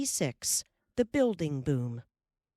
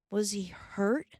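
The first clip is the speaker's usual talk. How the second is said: anxious; 5 semitones higher, slower than usual. A narrator's reading. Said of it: "Mary asked anxiously".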